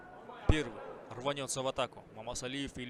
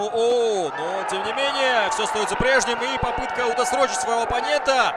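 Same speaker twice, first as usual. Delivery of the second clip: higher, louder fast-breaking play-by-play; excited commentary; 7 semitones higher, 11 dB louder than usual. Real, not acted. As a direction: erupt at the knockout. Commentator reacts to sudden knockout.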